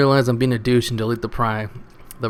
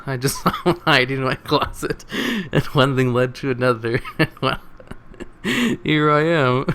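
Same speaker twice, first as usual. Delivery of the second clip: laughing; 3 semitones higher than usual. Real, not acted. Reader amused by the closet joke, talking through laughter. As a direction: try to read on while cracking up. Cracking up while reading the comment.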